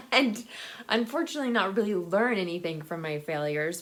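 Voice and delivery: normal talking voice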